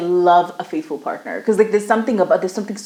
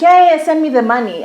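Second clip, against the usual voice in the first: high, loud, imitated eagerness; mock eager demand; 6 semitones higher, 5 dB louder than usual. Mimicking the scamming caller asking for cash, mock eager. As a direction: pipe up suddenly, mimicking someone keen for cash.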